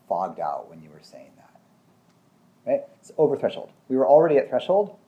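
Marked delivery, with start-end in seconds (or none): 0.1-2.7 slow, faint whisper, drifting away; fogged-out whisper. Retold or imitated. Client tells the therapist mid-session, fading into a slow whisper. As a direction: let the words trail off as if checking out.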